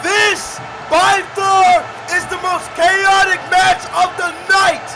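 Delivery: loud, high excited match call; live play-by-play. Acted. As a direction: scream the big spots to the crowd.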